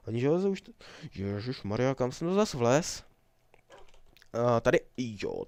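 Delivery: ordinary talk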